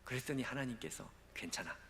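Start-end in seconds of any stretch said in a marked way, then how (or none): 1.3-1.8 soft, hushed comfort; gentle whisper. Acted. God reassuring the failed pastor, soft hushed whisper. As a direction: whisper that it is fine.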